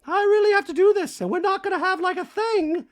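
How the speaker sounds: very high, loud hammed-up voice; exaggerated high Dorit impression; mock Dorit. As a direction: squeak it out like a fussy diva. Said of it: "Dorit's like".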